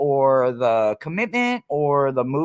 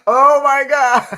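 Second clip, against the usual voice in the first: laughing exclamation; 11 semitones higher, 9 dB louder, slower than usual. Real, not acted. After laughing at her own joke.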